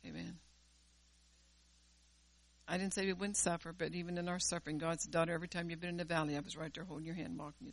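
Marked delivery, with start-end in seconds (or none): 5.1-7.7 soft, fading down to a whisper; tender reassurance. Retold or imitated. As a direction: comfort her like a father holding a hand. God's voice quoted tenderly.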